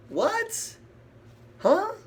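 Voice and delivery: hammed-up disbelief; mock surprise. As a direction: jump up in pitch, playfully shocked.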